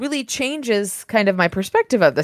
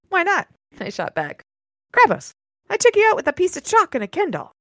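story narration; 6 semitones higher than usual. A narrator's reading. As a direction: read the passage like a novel narrator. Reads the novel aloud.

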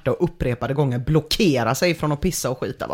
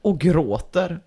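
usual speaking voice

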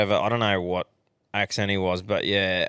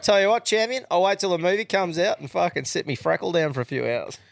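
cocky mock reply; 6 semitones higher than usual. Mate brushing off a book fan with a cheeky retort. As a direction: talk down to them like a know-it-all mate. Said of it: "I was like".

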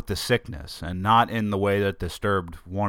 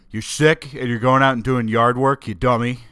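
a hint of a scolding listener, louder; mock scolding listener; 4 semitones higher, 6 dB louder than usual. Imagined listener scolds the sick host, mild mock scolding. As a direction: tease yourself as a nagging listener.

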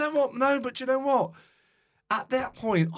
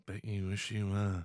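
lower, softer deadpan put-down; dry leaned-in quip; 8 semitones lower, 8 dB quieter than usual. Retold or imitated. Retelling a chat-show burn in a lightly voiced deadpan. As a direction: lean in and deliver the burn quietly. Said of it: "the guy leans in and goes".

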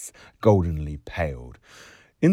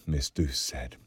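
usual speaking voice

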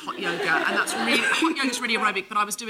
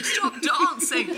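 slow, raised, mock complaint; complaining neighbour; 4 semitones higher, 3 dB louder, much slower than usual. Neighbour banging on the door, mock complaining. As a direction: draw it out like a fed-up neighbour through a door. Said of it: "banging on the door going".

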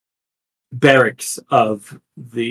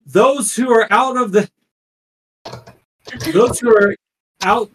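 town crier; 8 semitones higher than usual. Acted. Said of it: "town crier".

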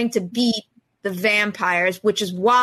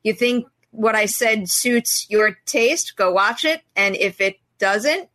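ordinary delivery

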